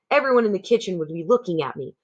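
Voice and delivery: ordinary delivery, nothing marked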